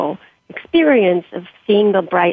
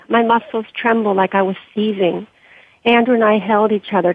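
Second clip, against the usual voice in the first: plain reading voice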